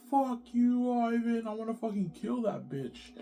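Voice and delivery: very high mock wife's tirade; wife giving hell. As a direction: imitate a furious wife in a high drawn-out voice. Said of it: "she gives me hell".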